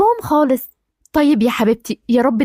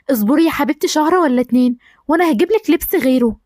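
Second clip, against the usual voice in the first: ordinary talk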